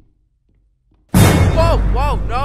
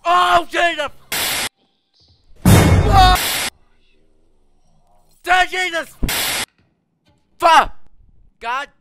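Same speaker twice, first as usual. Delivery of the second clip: panicked screaming; 14 dB louder than usual. Real, not acted. Panicked in horror game.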